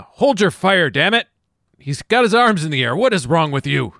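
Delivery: loud, high, indignant command; yelling at officers. Acted. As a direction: shout them down in outrage. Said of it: "I'm yelling at the officers".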